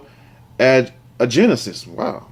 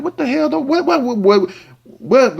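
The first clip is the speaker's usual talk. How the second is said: pitched way up, stammering what what what; high shocked disbelief; 10 semitones higher than usual. Retold or imitated. To the partner with nothing there, shocked high disbelief. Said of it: "You be like".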